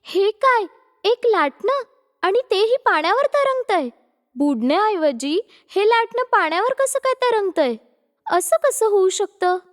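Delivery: put-on puzzled young woman amazed; astonished. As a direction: marvel aloud in disbelief. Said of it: "आश्चर्यचकित होऊन जाते".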